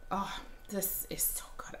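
soft breathy whisper, almost secret. Real, not acted. Savoring a scent quietly.

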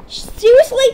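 high, loud incredulous squeal; loud disbelief. Real, not acted. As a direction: blurt it out in disbelief. Disbelief at friend's game choice.

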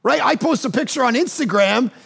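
fervent sermon voice; preaching at full force. Acted. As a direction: preach it hard to the congregation.

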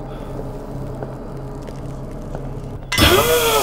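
long high breathy gasp. Real, not acted. Gamer at a new scare, long gasp. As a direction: suck in air in terror.

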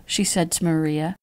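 ordinary delivery

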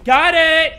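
high, very loud burst; over-the-top triumphant holler; odd shouted exclamation. Real, not acted. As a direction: blurt it out in a sudden yell. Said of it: "why'd I say it like that".

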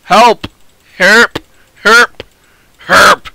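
loud high repeated calls, mangled into herp; calling for help. Real, not acted. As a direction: yell help over and over at teammates.

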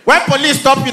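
ordinary talk, nothing marked

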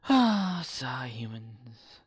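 slow, sighing mock disdain for humans; weary Spock. Spock sighing over the humans, slow and weary. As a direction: drag it out like a tired Vulcan. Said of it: "Spock's like".

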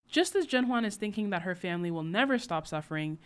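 ordinary talk, nothing marked

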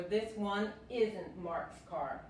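slow, clear contraction demo; teacher reads slowly for learners; slow model sentence. Shown as a demonstration. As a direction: spell out "one's not" slowly. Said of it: "Se puede decir".

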